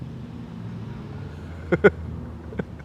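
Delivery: quiet chuckle at own joke; soft, amused laugh after the accent. Real, not acted. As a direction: chuckle to yourself.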